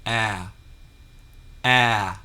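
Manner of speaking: "a" as the vowel that starts apple; isolated phonics "a", the apple vowel; short A sound. Shown as a demonstration. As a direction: say "a" alone as a short, open A, as in apple. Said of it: "The first sound that you must make".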